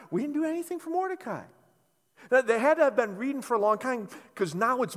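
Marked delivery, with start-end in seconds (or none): 0.1-1.4 servants' sheepish echo. Retold or imitated.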